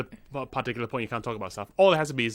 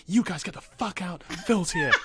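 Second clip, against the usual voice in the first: mock bossy order; 5 semitones higher than usual. Imagined hostess clearing a table.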